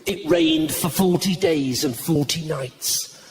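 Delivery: mock-solemn biblical recitation; old-fashioned Bible reading. Shown as a demonstration. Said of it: "people don't speak like that anymore".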